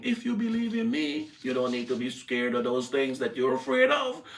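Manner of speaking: high, loud preaching with a shout; full-force preaching. Acted. Preacher telling the church Jesus has power, preached full force.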